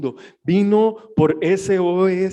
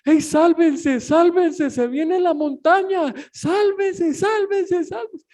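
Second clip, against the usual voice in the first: much higher, frantic warning yell; imitated desperate scream; 11 semitones higher, slower than usual. A woman warning her neighbors of the landslide, frantic high scream. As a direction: scream it like someone watching the mountain fall. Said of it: "gritándole a todas las casas".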